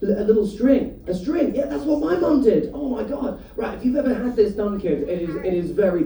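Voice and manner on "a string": ordinary talk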